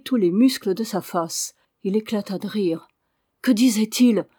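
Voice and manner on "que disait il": louder, pressing repeat of the question; insistent repeat. Acted. Father repeats his question to his silent son, louder. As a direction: press him for an answer. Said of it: "répéta le vieillard".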